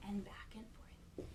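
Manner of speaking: hushed whisper, rocking rhythm. Acted. Son rocking his old mother, whispered softly.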